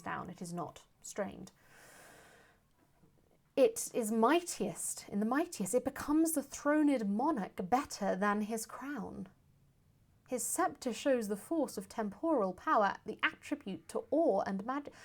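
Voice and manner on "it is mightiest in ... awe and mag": high, loud Shakespearean declamation; full-voiced stage delivery of verse; dramatic verse recitation. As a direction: proclaim the verse with rolling, swooping tune. Performs Portia's speech aloud.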